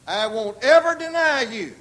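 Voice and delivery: loud, high, ringing vow; emphatic pledge; bold assertion. Retold or imitated. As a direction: proclaim unshakeable loyalty at full voice. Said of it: "make bold assertions and strong".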